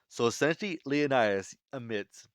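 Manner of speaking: ordinary delivery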